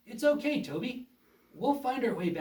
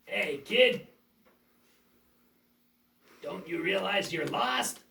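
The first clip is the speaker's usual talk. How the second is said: menacing villain; 3 semitones lower than usual. Acted. Villain taunts lost boy.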